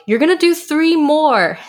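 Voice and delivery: retold coach yelling at a tired kid; mock coach yell. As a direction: bark it like a drill coach. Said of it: "the coach just yelled at me".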